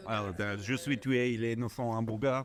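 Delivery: mock French gibberish; fake French. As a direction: ham it up like a Frenchman. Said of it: "fake French".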